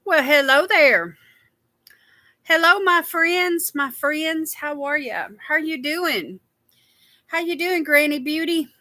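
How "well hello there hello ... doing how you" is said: slow, high, sing-song hello; drawn-out greeting. Real, not acted. Host drawing out a slow, high hello to viewers joining her stream. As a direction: stretch the hello out warmly to the viewers.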